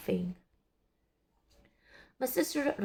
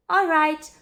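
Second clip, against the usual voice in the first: called out loudly, higher; calling out; 7 semitones higher, 8 dB louder than usual. A narrator's reading. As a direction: call it out across the yard. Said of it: "called Amelia Bedelia".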